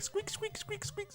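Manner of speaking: high, soft squeaky-toy noises; toy squeak. Retold or imitated. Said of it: "just a toy hammer".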